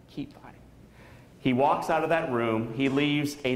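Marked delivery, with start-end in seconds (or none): 0.1-0.6 very soft, almost voiceless echo; hushed quote. Retold or imitated. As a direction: repeat the words under your breath. Repeats quoted line in a hush.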